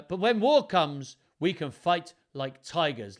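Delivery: rally the soldiers with a big voice; rousing battle cry. Acted. Henry rallies the troops.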